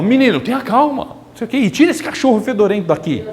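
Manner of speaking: mock strained calm that cracks into scolding; losing patience. A mother on an audio scolding her yelling son, irritated.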